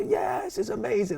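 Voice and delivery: very high-pitched mock American-audience gush; excited crowd. American audience gushing to the guest on the show, excited. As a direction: squeal it like a thrilled fan.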